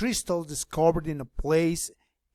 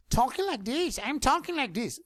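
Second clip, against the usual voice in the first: higher, cartoonish put-on voice; high goofy demo voice; funny voice; 8 semitones higher than usual. Shown as a demonstration. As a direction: put on a silly voice for the class. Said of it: "Do you hear that?".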